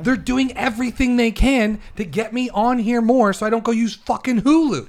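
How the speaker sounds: high-pitched, vein-popping exasperation; heated rant. Real, not acted. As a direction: rant as if veins will pop, pitch pushed up. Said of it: "your veins are gonna pop out".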